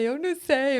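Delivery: high, loud mock wail; mock tearful. Employee asked by second boss what she'll do, mock tearful. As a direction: blurt it like a helpless crier, hammed-up. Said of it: "Chorava, chorava, chorava".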